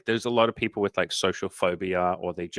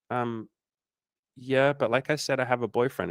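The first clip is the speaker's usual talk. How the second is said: awkward reminder; 4 semitones higher than usual. Retold or imitated. Acting woman's awkward reminder.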